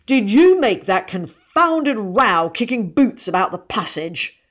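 loud, indignant outburst; wrathful spluttering. A narrator's reading. Father accusing his son of the row, wrathful. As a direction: burst out angrily, then drop off. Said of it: "spluttered the man of wrath".

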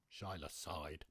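ordinary delivery, nothing marked

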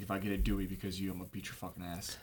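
low, soft threat; retold muttered threat. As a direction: imitate threatening under your breath. Retells buddy's muttered threat.

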